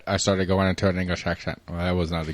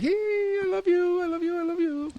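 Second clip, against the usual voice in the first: very high, slow whiny puppy voice, fading softer; whiny dog voice; 4 dB quieter, slower than usual. Retold or imitated. Voicing the dog Rowdy's whine to the host, very high and whiny. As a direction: whine it out like a needy little dog. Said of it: "doing his little whiny thing".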